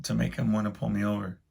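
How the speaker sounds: soft, worried muttering to himself; hushed worried self-talk; anxious inner thought. Retold or imitated. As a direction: wonder it quietly in a panic.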